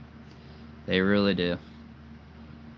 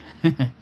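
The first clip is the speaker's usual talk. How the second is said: laugh; 5 semitones higher, 6 dB louder than usual. Real, not acted. Laughing at own impression.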